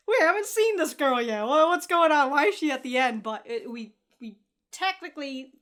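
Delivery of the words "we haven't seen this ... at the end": excited. Real, not acted. Realizing a new character appears.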